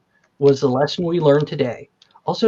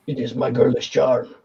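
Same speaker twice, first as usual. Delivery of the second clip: coy orc woman; 6 semitones lower than usual. Acted. Orc answers in put-on voice.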